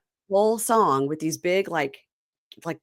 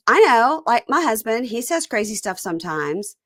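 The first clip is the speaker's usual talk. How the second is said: higher, louder mock housewife voice; imitating Libby; 5 semitones higher, 4 dB louder than usual. Retelling a reality show, mock Libby higher and louder. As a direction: play Libby brushing it off, high and loud. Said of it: "And Libby goes".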